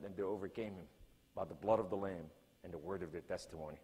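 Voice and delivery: low, soft, grave divine voice; God answering the preacher, low and solemn. Retold or imitated. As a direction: speak as God, quiet and weighty. Voicing God speaking.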